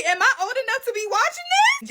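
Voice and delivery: high incredulous squeal; mock shocked squeal. Real, not acted. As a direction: squeak it like you're scandalized.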